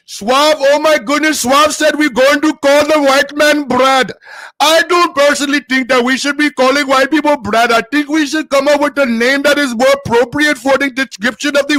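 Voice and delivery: loud, high, slowed mock Indian accent; indignant speech in a hammed-up Indian accent; put-on Indian accent. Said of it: "I gotta hang around some Indians".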